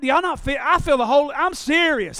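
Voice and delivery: ordinary talk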